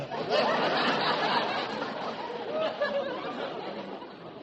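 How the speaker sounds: high breathy laugh. Real, not acted. Straight man laughs at Costello's Rita Hayworth joke. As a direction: crack up at the joke.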